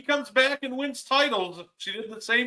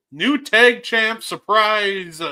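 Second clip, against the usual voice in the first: slow, louder announcing; slow announcement; 6 dB louder, slower than usual. Real, not acted. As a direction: draw it out like an announcer. Announcing new champion.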